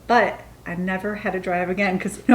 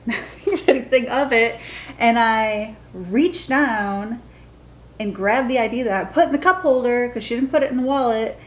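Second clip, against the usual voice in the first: ordinary talk, nothing marked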